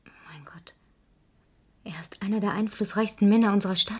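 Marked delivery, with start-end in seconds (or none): 0.0-2.2 shocked whisper. Acted. Shocked at the name.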